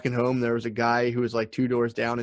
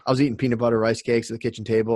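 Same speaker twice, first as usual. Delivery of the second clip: usual speaking voice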